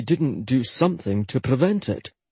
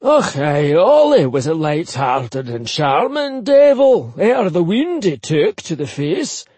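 put-on higher, chatty old Scotsman; old Highland man; 4 semitones higher, 4 dB louder than usual. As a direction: reassure her, gossipy Highland uncle. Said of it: "a Caer Buchanan assured Jetta".

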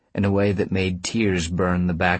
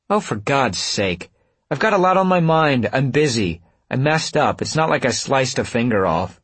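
high, defensive outburst; fed-up protest, pitch up; exasperated; 7 semitones higher, 4 dB louder than usual. A narrator's reading. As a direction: defend yourself irritably.

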